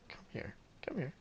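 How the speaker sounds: coaxing sultry whisper; seductive whisper. Acted. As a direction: breathe it like a secret invitation. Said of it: "more of, like, a".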